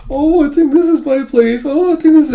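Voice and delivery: mock a befuddled old man's repeated discovery; old man impression. Imitates confused old man's gag.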